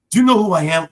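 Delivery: put-on high, loud Nigerian-accented boast; big, self-important Nigerian-accent proclamation; mock-pompous Nigerian accent. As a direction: imitate a son grandly declaiming in a Nigerian accent. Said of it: "did it in a Nigerian accent".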